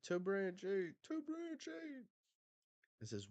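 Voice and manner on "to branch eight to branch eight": high, stretched mimic of the giant call, fading; mock giant echo. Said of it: "And I said".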